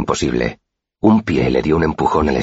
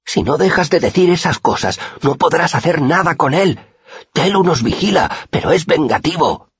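reverent fearful warning. A narrator's reading. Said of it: "con tono reverente y temeroso".

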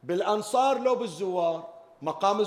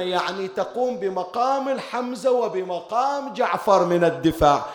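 rising, louder preaching lament, slowing on the peak; preaching at full force; 6 dB louder than usual. Acted. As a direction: drive the mourning home to the crowd. Preaching to mourners. (خطابة حسينية حماسية مرتفعة)

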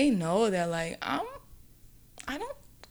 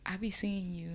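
hushed creepy quote; 8 dB quieter than usual. Retold or imitated. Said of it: "He was like".

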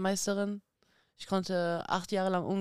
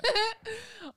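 a real, high laugh; high burst of laughter. Real, not acted. Boxer laughing at her own story, high giggle. Laughs after boasting about brother.

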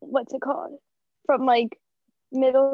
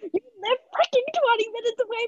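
exasperated; 10 semitones higher than usual. Real, not acted. Defending herself against friend's complaint.

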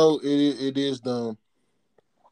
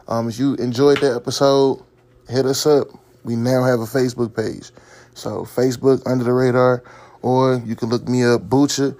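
loud, hyped sign-off; outro announcer; 7 dB louder than usual. Acted. Outro shout-out to listeners.

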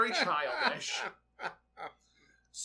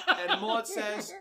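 laughing; 4 semitones higher, 5 dB louder, slower than usual. Real, not acted. Laughing while retelling.

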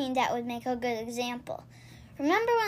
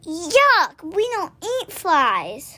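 louder yuck, slow disgusted rabbits; spluttering disgust; 3 semitones higher, 5 dB louder, much slower than usual. A narrator's reading. Rabbits refuse the frog's flies, spluttering yuck. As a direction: spit out the idea in disgust. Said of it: "spluttered the little rabbits".

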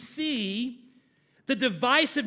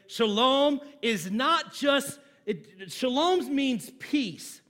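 ordinary talk, nothing marked

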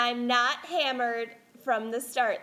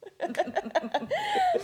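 laughing; 8 semitones higher than usual. Real, not acted. Host laughs opening the show.

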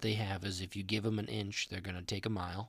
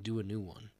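ordinary delivery

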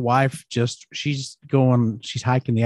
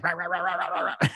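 put-on high, soft grumbling old dog yap; grumpy dog grumble; 6 semitones higher, 5 dB quieter than usual. Owner voicing the old lab telling the pup off, soft high grumble. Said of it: "she's like".

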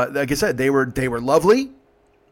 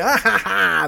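high put-on laugh; mock "ah ha ha" cackle; imitated group laughter; 6 semitones higher, slower than usual. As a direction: mimic passengers cracking up. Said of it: "they laugh".

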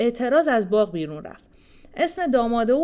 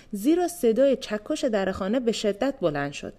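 ordinary delivery

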